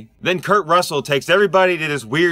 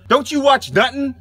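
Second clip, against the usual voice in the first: ordinary talk, nothing marked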